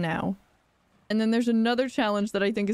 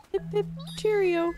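high, short chirps; duckling-calling voice; 9 semitones higher than usual. Pretend. Player calls the duckling just named, high chirpy voice. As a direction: pip it high, like a little bird.

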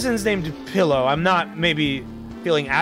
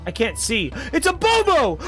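normal talking voice

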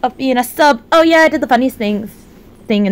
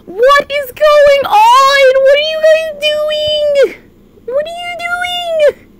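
much higher, slow, stretched-out questions; high disbelief; 11 semitones higher, slower than usual. Real, not acted. Streamer asking chat in shock why they are gifting subs.

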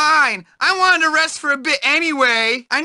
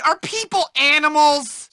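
normal talking voice

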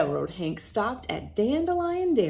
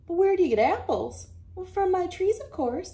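higher quick exchange; puzzled then obvious; 10 semitones higher, 4 dB louder than usual. Acted. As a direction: play both voices brightly. Question and answer voices.

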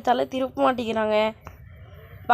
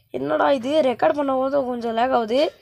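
normal talking voice

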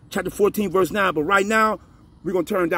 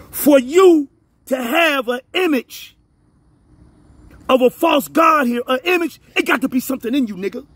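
raised, higher-pitched forceful delivery; emphatic preaching; 4 semitones higher than usual. Real, not acted. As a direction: hammer the point home in separated phrases. Preacher driving home his argument.

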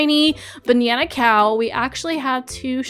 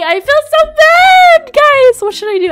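anguished squeal; 11 dB louder than usual. Real, not acted. Gamer telling viewers she feels bad, very high loud anguished squeal. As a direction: squeal it like it physically hurts. Torn over declining another offer.

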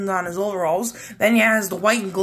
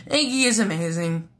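normal talking voice

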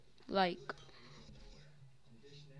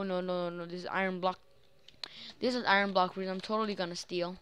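ordinary delivery

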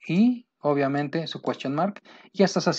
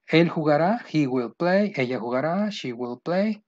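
ordinary talk, nothing marked

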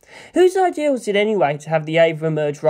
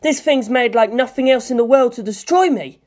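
louder, higher, panicking; panicked shout; 8 semitones higher, 4 dB louder than usual. A narrator's reading. Shinji screaming at the Evangelion, higher louder panic. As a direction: yell it like you're cornered. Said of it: "Shinji screamed to the Evangelion".

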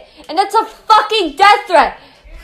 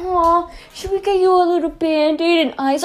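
softer, sarcastic pity; mock sympathy; 3 semitones lower, 6 dB quieter than usual. Ranter mocking an opponent, fake sympathy. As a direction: coddle them with fake concern.

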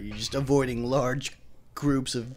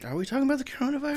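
mock high voice; 10 semitones higher, 5 dB quieter, faster than usual. Playing dumb with put-on voice.

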